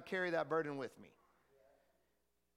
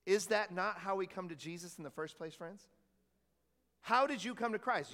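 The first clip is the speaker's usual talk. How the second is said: ordinary talk, nothing marked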